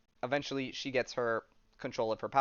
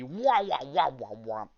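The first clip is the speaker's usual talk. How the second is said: mock sound effect; 3 semitones higher, 4 dB louder, slower than usual. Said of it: "That's the sound effect that that makes".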